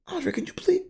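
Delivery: a hint of an indignant mother; imitating mom. Mom complaining to her son about the paper plates. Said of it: "my mom was like".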